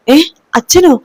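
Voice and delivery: high, stunned question; shocked disbelief. Acted. Sarayu told of her father's death, asks back in shock. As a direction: catch the news like a blow.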